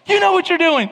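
very high mock squeal; wife's playful protest. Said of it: "She goes".

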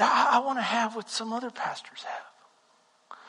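whispered confession. Retold or imitated. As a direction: breathe it out as a secret. Confessing his wish to God.

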